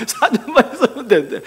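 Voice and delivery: laughing through 사 년만 있으면 되는데. Real, not acted. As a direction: chuckle while repeating the four years. Pastor repeats it, laughing.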